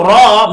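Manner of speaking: "ra" drawn out slowly as "raw"; rounded o vowel. Shown as a demonstration. Teacher explaining the letter's name, long rounded "ra". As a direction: stretch "ra" with a rounded o sound. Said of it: "it's pronounced as o".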